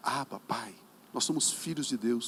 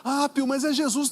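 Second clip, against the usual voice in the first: imitated objection; 9 semitones higher, 6 dB louder than usual. Voices an objecting believer.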